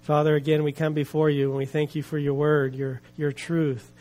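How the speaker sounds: low, unhurried praying voice; prayer. Real, not acted. Preacher leading listeners in prayer before the story, low and reverent.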